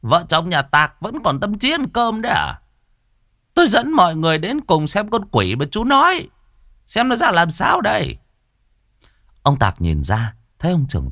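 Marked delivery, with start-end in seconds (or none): 0.0-8.2 higher, taunting chief; swaggering challenge. Acted. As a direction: barge in and mock. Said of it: "hùng hổ vào nhà".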